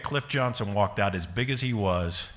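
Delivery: ordinary talk, nothing marked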